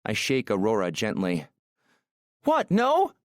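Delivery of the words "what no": high, startled yelp in Aurora's voice; alarmed yell. A narrator's reading. Woman shaken awake by Maxim, yelling in alarm. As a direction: cry out high as if jolted awake. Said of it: "she yells".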